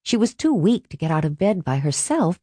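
ordinary delivery

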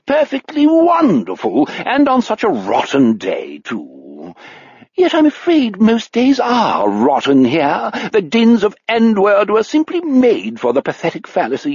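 bogus English aristocrat. A narrator's reading. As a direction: play a fake upper-class English toff from a fifties farce. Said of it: "sounds like a bogus English aristocrat".

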